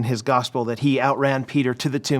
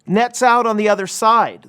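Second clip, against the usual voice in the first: raised, carrying call, retold from the pulpit; retold yell; 8 semitones higher, 5 dB louder than usual. Stranger on the beach to the fishermen, imitated yell. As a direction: imitate a stranger calling out, higher and louder. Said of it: "he yells out to them".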